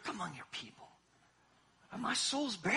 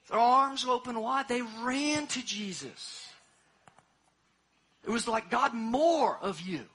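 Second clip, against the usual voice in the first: loud, rising sermon climax; full-force preaching; 3 semitones higher, 8 dB louder, slower than usual. Acted. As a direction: preach it out, big and ringing.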